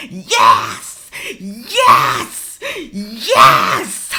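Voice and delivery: mock congregation shouts, punched loud; cheering congregation. Mocking a congregation clapping and praising the pastor, loud imitated cheering. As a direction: imitate a worked-up church crowd shouting.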